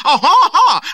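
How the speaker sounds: high, loud mocking laugh; scornful laugh. A narrator's reading. Cardigan laughs scornfully at the captain.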